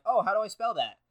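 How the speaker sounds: a hint of a puzzled Frenchman; mock Frenchman. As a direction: lightly play a confused French speaker. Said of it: "and they're like".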